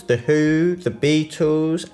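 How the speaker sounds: ordinary talk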